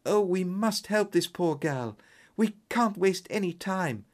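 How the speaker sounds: put-on high, urgent plea from a young lady; high-pitched, worried client's cry; distressed client. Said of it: "cried our client".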